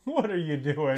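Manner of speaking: playful incredulous question; amused disbelief. Acted. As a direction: react baffled through laughter. Mock reaction to overacted scene.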